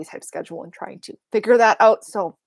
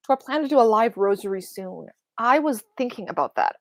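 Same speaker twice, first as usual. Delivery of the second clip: usual speaking voice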